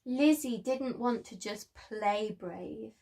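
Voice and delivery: plain reading voice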